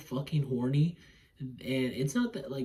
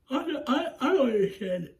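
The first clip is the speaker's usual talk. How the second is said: high, strained yawning speech; talking through a yawn; 12 semitones higher, 5 dB louder than usual. Real, not acted. Reviewer interrupted mid-review by yawns, talking through one. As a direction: let a real yawn swallow the words. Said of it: "why I keep yawning".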